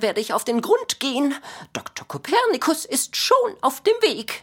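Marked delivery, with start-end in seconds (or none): 1.7-4.4 high, eager self-announcement; proud announcement. Acted. Kopernikus announces himself as doctor, proudly. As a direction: puff up as the doctor.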